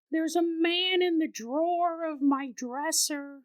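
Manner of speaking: put-on high, slow child's voice; a toddler tells of the man; slow, high, eerie child voice; creepy little girl. Toddler daughter voiced in story.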